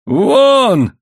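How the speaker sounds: long, high, loud yell; hoarse shout. A narrator's reading. Husband raising his fist shouts at wife, hoarse. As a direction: yell the one word hoarsely, held out. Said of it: "хрипло выкрикнул он".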